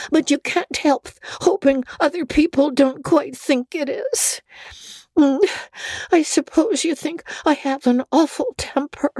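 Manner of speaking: put-on high girlish voice, tearful and hurt; a child explaining her temper, near tears; upset young girl. Girl's voice held.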